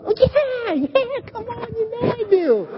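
high, casual, brushing-off voice; playful eager Peter, high and breezy. Retold or imitated. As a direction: shrug it off, of course I love you. Peter brushing off Jesus' question.

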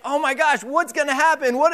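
mock panic. Father retelling his panic at the open door, mock alarmed. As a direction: replay the alarm, half joking. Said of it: "I was like".

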